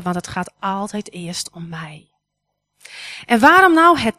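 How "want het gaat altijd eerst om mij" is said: soft unvoiced whisper; whispered aside. Real, not acted. To the congregation, admitting it starts with her, whispered. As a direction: let it slip out as a private confession.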